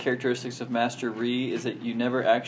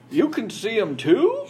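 put-on surprised old man; 9 semitones higher, 6 dB louder, slower than usual. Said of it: "and he says".

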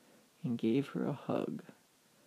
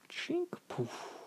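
umbrella sound effect; 4 dB quieter than usual. A narrator's reading. Storyteller as the umbrella opens mid-fall, imitated noise. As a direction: imitate an umbrella popping open.

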